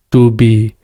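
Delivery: normal talking voice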